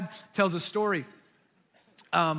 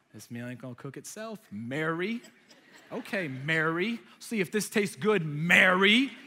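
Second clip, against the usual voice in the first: getting riled up; 3 semitones lower than usual. Retold or imitated. Martha cooking, needling her sister Mary, increasingly riled. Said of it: "she's getting riled up".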